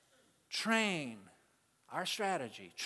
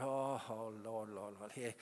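low, soft exasperated groaning; Jesus fed up with the disciples, low and soft; frustrated sigh; 5 semitones lower, 4 dB quieter than usual. Retold or imitated. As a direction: sigh it out like you've had enough. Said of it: "Jesus kinda got frustrated".

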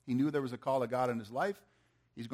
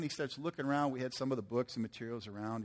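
ordinary delivery

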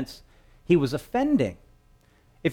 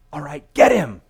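rough shout; 3 semitones higher, 6 dB louder than usual. Acted. Crowd rushing to grab Jesus.

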